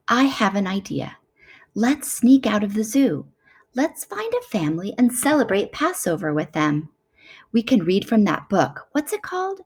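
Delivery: ordinary delivery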